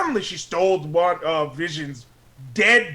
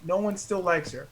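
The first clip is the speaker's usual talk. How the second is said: ordinary talk, nothing marked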